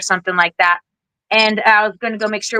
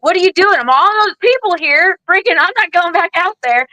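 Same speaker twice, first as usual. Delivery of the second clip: panicked; 9 semitones higher than usual. Retold or imitated. Hiding under the desk, refusing to go back out, panicked. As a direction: squeal it out like you're cornered.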